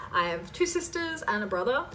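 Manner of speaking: ordinary delivery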